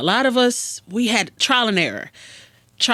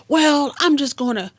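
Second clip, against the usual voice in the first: lightly voiced kid, pitched up; a hint of a higher kid voice; acted kid; 9 semitones higher, 3 dB louder than usual. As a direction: protest like a teenager with no plan. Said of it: "take a kid through a script".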